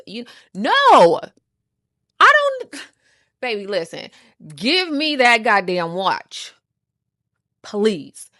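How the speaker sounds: fed-up loud pleading; exasperated yell. Retold or imitated. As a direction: blow up then beg for the watch. Acted exasperated plea.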